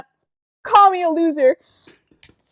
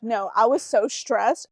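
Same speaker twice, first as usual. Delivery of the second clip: usual speaking voice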